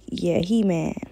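usual speaking voice

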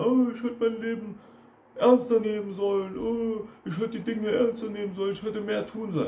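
high-pitched mock wailing; mock weeping. Coach mimics someone coming to him later with regrets, mock weeping. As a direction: imitate a tearful, whining regret. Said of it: "komm später nicht weinend zu mir".

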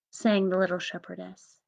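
usual speaking voice

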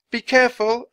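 high, loud warning; girl's high-pitched call; shouted. A narrator's reading. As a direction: shout a warning back to him. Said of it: "Annie shouted to Basho".

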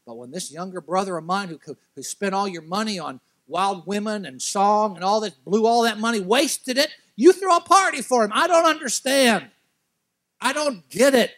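rising from level to very high and loud; building outrage. Acted. Older son yelling at his father about the party, building to fury. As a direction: let the grievance explode.

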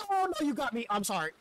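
high, loud surprised cry; startled yelp. Real, not acted. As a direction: caught off guard, yelp it. Shark bites after taunting.

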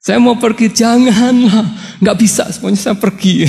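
a wife begging her husband not to leave, hammed up; playful acted tug-of-war; mock quarrel. Couple's tug-of-war over leaving.

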